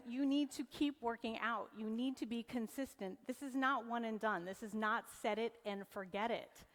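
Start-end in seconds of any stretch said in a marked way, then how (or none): none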